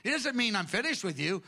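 ordinary delivery

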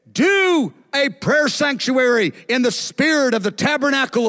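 high, emphatic retold command; voice of the Lord. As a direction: declare it like a divine commission. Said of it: "it was like I heard his voice".

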